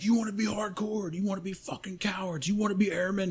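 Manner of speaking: high-pitched imitated drill instructor challenge; pumping up, instilling fear. Instructor addressing the lined-up flight, high-pitched intimidating pep talk. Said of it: "pumping us up, but at the same time instilling fear".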